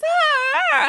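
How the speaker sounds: high, sharp mock dog yelps; mimicked dog cries. Narrator imitating her dog crying on the leash. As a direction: yelp like the straining dog. Imitating the dog's choking cry.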